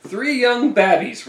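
put-on rough Jersey accent. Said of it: "he's got the whole, like, Jersey".

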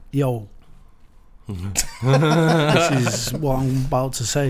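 stretched mock battle-rap intro; slow mock rapper. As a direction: drag it out like a rapper stalling for time. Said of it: "It's not if you go".

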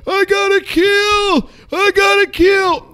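high, drawn-out victory shout; triumphant yell. Retold or imitated. Gamer announcing his kill to the chat, triumphant yell. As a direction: re-enact a jubilant full-voice yell, way up high. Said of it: "And I yelled".

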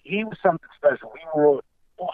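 usual speaking voice

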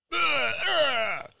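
high, hammed-up groan of disgust. Mocking leftists' visceral reaction, hammed-up disgusted groans. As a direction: retch at the thought. Mimicking leftists' physical revulsion.

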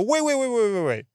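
desperate stop-him calls; frantic interrupting. Retold or imitated. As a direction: cut him off in a panic. Again interrupting the agent.

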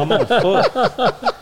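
slow, high laughing line; laughing about chasing a truck on foot. Real, not acted. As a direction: stretch it out laughing. Laughing at his own plan.

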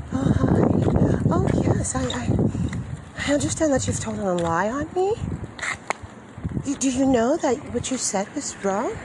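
high, sweet, mock-innocent voice, all politeness; sugary polite voice. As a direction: play the nice lady who never gets angry. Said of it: "not supposed to have any signs of aggression or hostility in my voice".

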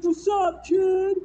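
loud hammed-up yo greeting; mock gangster shout. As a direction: bark it like a wannabe rapper. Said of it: "It's like".

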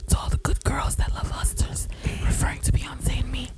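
breathy ASMR whisper, unvoiced. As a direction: whisper close to the mic like an ASMR video. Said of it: "I can't do that A S M R shit".

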